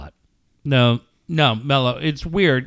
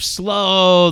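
"slow" stretched long; drawn-out emphasis; 4 semitones higher, 3 dB louder than usual. Real, not acted. Cohost griping about Tesla drivers, "slow" stretched out. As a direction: drag out the vowel of "slow".

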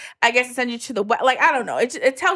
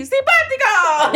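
"Simpático!" much higher and slowed, each syllable bursting with joy; with energy; 11 semitones higher, slower than usual. Shown as a demonstration. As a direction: throw "Simpático!" out like a happy cheer, laughing. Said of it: "You gotta say it with energy".

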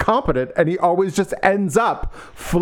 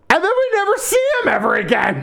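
outraged shout; 13 semitones higher than usual. Real, not acted. Friend complains about a vanished character, shouting high. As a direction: shout it out in mock outrage at the book.

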